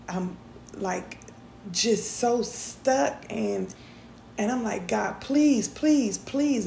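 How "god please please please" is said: higher, louder desperate pleading; retelling a frantic plea to God; begging God. Retold or imitated. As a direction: beg God over and over, voice rising. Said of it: "And I went to begging".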